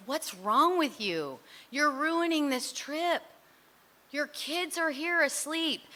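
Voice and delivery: slow, high exasperated scolding; mom scolding. Retold or imitated. Mother scolds her daughter on a family trip, slow and high. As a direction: pull each word out like a fed-up mother.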